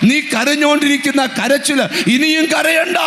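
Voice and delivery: high, ringing pulpit cry; proclaiming shout. Acted. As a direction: declare it over the crowd. Pastor proclaims God's word. (ഉച്ചത്തിൽ പ്രഖ്യാപനം)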